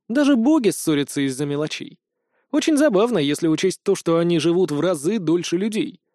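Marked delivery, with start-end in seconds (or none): none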